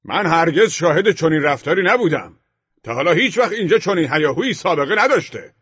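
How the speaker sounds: very high-pitched, loud rant; heated quarrel at full pitch; angry shouting. A narrator's reading. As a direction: yell your outrage at the top of your range. Neighbour rants in escalating quarrel.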